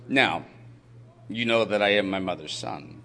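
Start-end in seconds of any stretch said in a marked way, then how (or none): none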